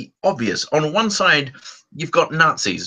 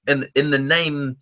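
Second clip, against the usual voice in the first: ordinary talk, nothing marked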